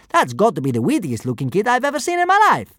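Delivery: high objecting kidnapper. A narrator's reading. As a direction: protest it loudly. First kidnapper continues.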